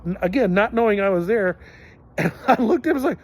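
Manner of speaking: usual speaking voice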